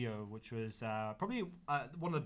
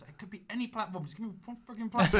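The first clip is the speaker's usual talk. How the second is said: rising exasperated plea, higher; mock frustrated, getting louder; frustrated demand; 7 semitones higher, 6 dB louder than usual.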